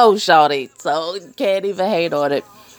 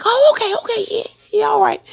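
high, hurried backing-off imitation; flustered cousin; faster than usual. Mimicking cousin-in-law retreating after hearing she's married, high and flustered. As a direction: play him caught off guard, high and quick. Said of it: "And he's like".

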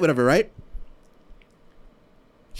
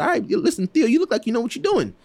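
high, lightly voiced quote of a buddy; mock high voice for Jim backing him up; friend's voice; 8 semitones higher than usual. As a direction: pitch it up to play the friend. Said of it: "He said".

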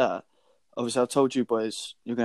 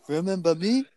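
lightly voiced cheery porter, higher; mock-friendly greeting to the patient; imitating the porter; 6 semitones higher than usual. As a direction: lean in with a chirpy "Remember me?".

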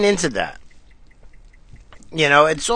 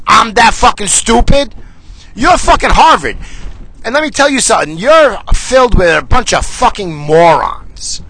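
louder, higher furious yelling; angry rant; 6 semitones higher, 4 dB louder than usual. Real, not acted. Ranting at Harvard, angry and louder. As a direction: tear into them, yelling.

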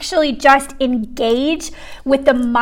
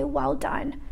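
"Well done" said much softer, hushed and tender; 12 dB quieter than usual. Retold or imitated. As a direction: breathe "Well done" softly in awe. Tender repeat of the verse.

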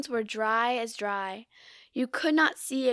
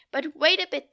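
a sudden raised call, louder and higher; crying out; 5 semitones higher, 6 dB louder than usual. A narrator's reading. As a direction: call out to stop them. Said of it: "the oysters cried".

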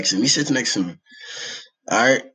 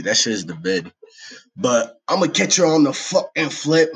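ordinary talk

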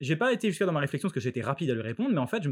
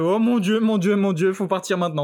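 mock panic; 4 semitones higher, 6 dB louder than usual. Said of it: "j'ai fait".

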